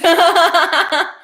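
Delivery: high, loud walrus-like cackle; dying-walrus laugh. Pretend. Reacting to a donor's name, loud dying-walrus laugh. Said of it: "sounds like a dying walrus".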